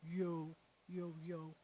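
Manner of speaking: soft, low "yo" sounded out; syllable drill. Shown as a demonstration. Teacher quietly drills the syllable yo. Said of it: "And then we have".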